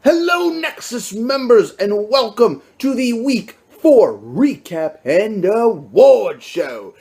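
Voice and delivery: high, slow award-show host voice; changed announcer voice. Pretend. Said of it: "I'm gonna change my accent to".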